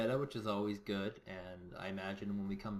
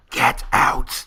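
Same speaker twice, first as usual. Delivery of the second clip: imagined panicked-commander yell, very loud; 21 dB louder than usual. Acted. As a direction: yell it at full volume, like ordering troops out. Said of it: "their heads are yelling".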